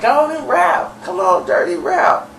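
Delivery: high, coaxing mock voice; high-pitched urging. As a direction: imitate a woman egging someone on. Voices [name] urging [name] to rap.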